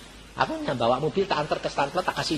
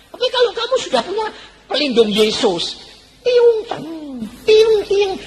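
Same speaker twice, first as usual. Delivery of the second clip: ricochet noises; 6 dB louder than usual. Retold or imitated. Insults bouncing off Jesus' shield.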